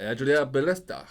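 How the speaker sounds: "Adria Ballesta" said with a Spanish accent and rolled sounds. Shown as a demonstration. Host showing his Spanish accent on the name, said in Spanish. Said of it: "You know, the, the accent".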